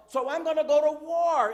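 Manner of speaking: mock war cry, full volume; loud defiant declaration. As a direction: declare it forcefully, as a baited retort. Acting out the baited response.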